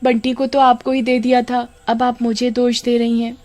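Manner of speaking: high, sugary, nervous denial; fake innocence. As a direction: sweet-talk to cover a lie. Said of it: "पोल खुल जाने के डर से". (पोल खुलने से डरी कामिनी, बनावटी मीठी सफ़ाई)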